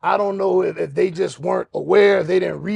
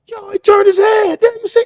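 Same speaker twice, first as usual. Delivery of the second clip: much higher, louder excited shout; amazed yell; 7 dB louder than usual. Retold or imitated. Inmates seeing the mantis turn its head, high excited shout. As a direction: point it out in wonder. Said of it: "Turned his head and went".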